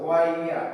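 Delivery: ওয়াইয়া as one smooth ɔɪə glide; triphthong demo. Shown as a demonstration. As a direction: blend ওয়াইয়া into one sound. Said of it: "তোমরা practice করতে হবে". (ট্রিপথং ওয়াইয়া মসৃণ করে)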